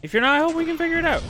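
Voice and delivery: a cartoonish squeak, quick and bright; chipmunk-fast high aside; squeaky high voice. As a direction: jump way up high and rattle it off fast. Sudden high fast put-on voice.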